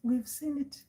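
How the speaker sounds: hushed, resigned elder voice; soft, breathy ancestral granny; great-grandmother spirit. Acted. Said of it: "She's like".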